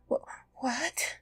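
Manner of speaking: put-on tearful, shaky little-boy whisper; frightened stammer. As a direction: stammer it through fear. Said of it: "He stammered out".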